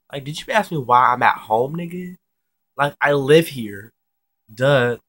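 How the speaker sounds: mock the dumb question, hammering it out; incredulous sarcasm. Mocks his dumb question.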